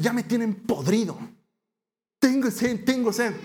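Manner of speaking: hammed-up furious tirade; Moses ranting. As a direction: give them the scolding of their lives. Moses rants at people.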